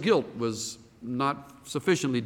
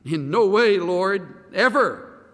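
emphatic refusal; 5 semitones higher, 5 dB louder, slower than usual. Retold or imitated. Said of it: "That's the thought".

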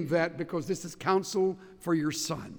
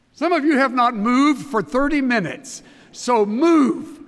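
louder, urging the class to get moving; loud rousing call; 6 semitones higher, 10 dB louder than usual. Real, not acted. Preacher to students, loudly telling them to move. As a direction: boom it out to stir a sleepy room.

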